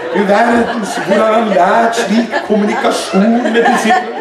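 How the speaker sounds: hammed-up awestruck marveling; mock admiration. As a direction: gush in a put-on, sarcastic wonder. Mocking imagined awestruck disciples.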